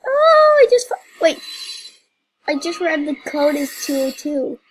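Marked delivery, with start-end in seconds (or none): 0.1-1.4 high, loud startled cry; jolted by a sudden surprise; real loud gasp of shock; shocked outburst. Real, not acted.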